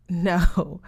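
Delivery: a laughing no, lightly amused. A narrator's reading. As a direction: brush it off with a laugh. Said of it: "Brooke said, laughing".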